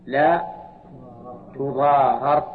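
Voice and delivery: لا تضارر with fatha on the first r, tuḍārar; passive form spelled out. Shown as a demonstration. Teacher showing the passive reading, r split with a. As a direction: say لا تضارر ending ra-r with an a. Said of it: "صار فك الإدغام هكذا".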